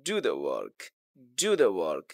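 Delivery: low command with "work" dropping; low, falling demo of an order; falling tone imperative. Shown as a demonstration. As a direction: drop "work" to the bottom of the voice. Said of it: "imperative sentence".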